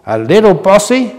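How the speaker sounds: high, airy sniff of indignation; mock-offended airy retort; sniffing airily. A narrator's reading. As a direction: toss it back, airily offended. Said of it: "Breath sniffed airily".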